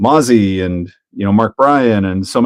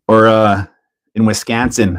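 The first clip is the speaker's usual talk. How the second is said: ordinary delivery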